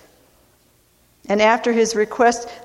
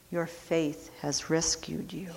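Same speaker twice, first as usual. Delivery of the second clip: much softer, tender repeat; soft echo; 3 semitones lower, 13 dB quieter than usual. Acted. Jesus reassuring the healed man, soft tender echo. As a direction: let it fall to a murmur.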